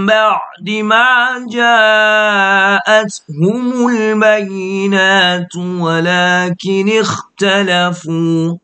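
slow melodic chanted recitation, long drawn vowels; Quran recitation. Acted. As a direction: chant it like tajweed recitation, unhurried. Teacher recites Quran before lesson. (อ่านอัลกุรอานเป็นทำนองช้าๆ)

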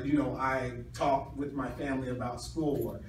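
normal talking voice

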